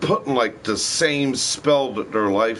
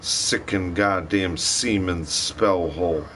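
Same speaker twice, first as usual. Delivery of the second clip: normal talking voice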